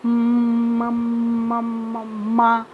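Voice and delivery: म held very long as a hum before मा; stuttering block. Shown as a demonstration. Teacher showing a child's stutter, stuck on a long म. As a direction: stick on म, drawing it out slowly until मा breaks through. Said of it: "बार-बार पुनरावृत्ति करता है".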